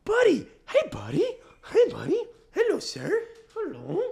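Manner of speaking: very high cooing dog voice; baby talk. Shown as a demonstration. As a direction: squeak greetings as if to a baby. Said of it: "speak to him like he's a baby".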